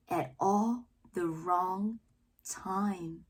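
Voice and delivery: usual speaking voice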